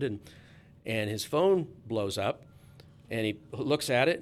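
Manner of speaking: usual speaking voice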